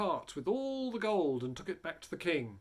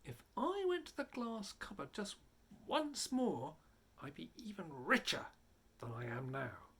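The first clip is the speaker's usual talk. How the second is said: greedy inner thought; 5 dB quieter than usual. A narrator's reading. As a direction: think it like a sneaky thief. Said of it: "He kept thinking".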